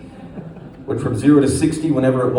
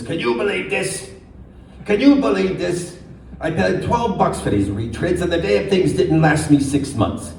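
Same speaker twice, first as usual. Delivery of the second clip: higher Yiddish-flavored kvetching voice; Yiddish accent; 4 semitones higher than usual. Acted.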